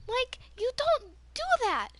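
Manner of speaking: high, loud, drawn-up reproach; indignant scolding. Retold or imitated. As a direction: tell him off in disbelief. Scolding the ex in retelling.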